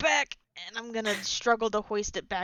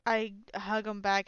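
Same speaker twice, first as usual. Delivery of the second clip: usual speaking voice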